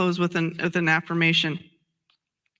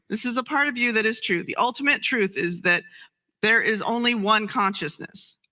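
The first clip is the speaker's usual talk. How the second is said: normal talking voice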